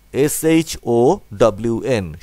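S H O W N read letter by letter, slowly; spelling out letters. Shown as a demonstration. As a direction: spell it for the class. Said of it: "এইভাবে ভেঙে ভেঙে উচ্চারণ করবে". (বানান করে পড়া)

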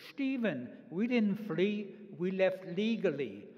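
put-on high, slow German-accented mother correcting her son; mother's heavy German accent. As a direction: imitate an insistent German mother. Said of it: "she had a very heavy accent".

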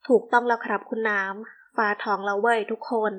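usual speaking voice

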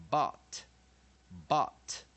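loud, separated teaching repeats of "but" as "bot"; short O drill. Shown as a demonstration. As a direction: punch "but" as "baht", crisp final T. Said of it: "Short vowel, O".

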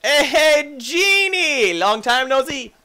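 high, loud hail; exuberant greeting. Pretend. Player greeting Genie on the line, exuberant greeting. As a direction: call out to a long-lost pal.

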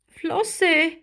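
usual speaking voice

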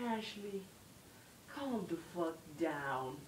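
hushed, measured acted reassurance; calming her down. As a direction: soften right down and stretch each word. Retold attempt to calm her.

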